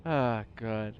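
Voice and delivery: ordinary talk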